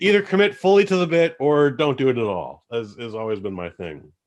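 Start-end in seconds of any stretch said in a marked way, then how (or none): 0.0-2.6 raised rant. Real, not acted. Ranting about half-hearted accents.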